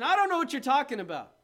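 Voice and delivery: high, loud protest of ignorance; loud mock denial. Preacher playing a denier before bystanders, loud protest. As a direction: act out an indignant-sounding public denial. Acted public denial of Jesus.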